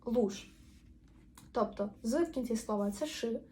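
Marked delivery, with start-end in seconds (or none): 0.0-0.5 "Луж" ending in voiceless sh; вимова з ш. Shown as a demonstration. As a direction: say "Луж" as "lush". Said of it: "в кінці слова воно буде читатися як ши". («Луж» із глухим Ш у кінці)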